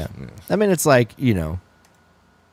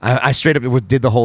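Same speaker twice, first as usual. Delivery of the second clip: usual speaking voice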